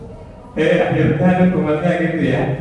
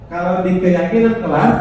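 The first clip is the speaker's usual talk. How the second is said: ordinary talk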